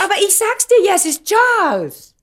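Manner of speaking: high, loud insistence; insistent exclaim. Acted. Insists loudly on the name.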